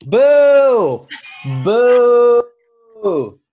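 booing a friend who put his shirt on; loud booing. Real, not acted. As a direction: jeer him playfully for covering up.

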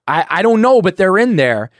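high, loud, desperate protest; frantic insisting. Retold or imitated. Insisting to his sponsor, high and panicked. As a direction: plead that they're really there.

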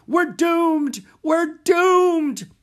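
very high, loud melodramatic wail; despairing cry. A narrator's reading. Theater manager laments to his assistant, wailing loudly. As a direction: wail it with doom stretched out. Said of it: "cried Mister Dewey".

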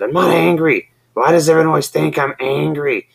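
high, loud put-on protest; mock angry denial. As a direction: insist you're not angry while clearly angry. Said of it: "And Dick just said".

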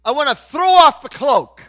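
high, loud declaration; preacher's full-voiced reenacted outburst; retold defiant cry. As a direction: shout it out as a firm resolve. Acting out own cry to God.

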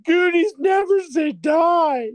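loud, high drawn-out wail; hammed-up weepy battle cry, slow and squealing; mock tearful. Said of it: "all watered up".

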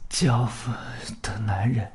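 breathless dying whisper; hoarse whisper. Acted. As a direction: rasp it in a whisper. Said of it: "他的声音太嘶哑了". (嘶哑的气声)

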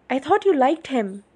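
high, loud protest; bewildered yelling. A narrator's reading. Charlie confronts Bella on her way out, yelling. Acted father's yelling at daughter.

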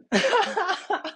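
high helpless laughing fit; cracking up. Real, not acted. Podcaster remembering his buddy's story, cracking up.

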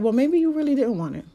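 a God who has seen it before, lightly teasing; God's voice. Retold or imitated. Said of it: "And God is like".

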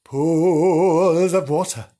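drawn-out mock eerie pools of water; mock ominous. Real, not acted. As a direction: send up the ghost claim. Mock spooky repeat of claim.

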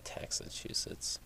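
slow teasing whisper. Acted. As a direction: breathe the nickname slowly.

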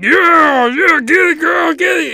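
high, loud whooping encouragement; cheering pole voice. Acted. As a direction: holler it like a rowdy cheerleader. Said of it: "And the pole's like".

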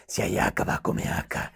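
low, voiceless menacing whisper; mother threatening the child outside, Batman voice. Retold or imitated. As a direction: hiss it like Batman. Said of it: "la misma voz de Batman".

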